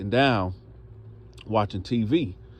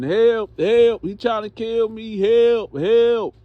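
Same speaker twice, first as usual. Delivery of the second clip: high, loud mock cries; acted screaming for help; 11 semitones higher, 6 dB louder, slower than usual. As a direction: yell out as if pretending to be attacked. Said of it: "yelling, hollering, screaming".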